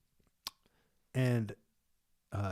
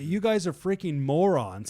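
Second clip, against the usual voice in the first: raised, louder exasperated insult; mock annoyed; 7 semitones higher, 7 dB louder than usual. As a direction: act out a man fed up with fools.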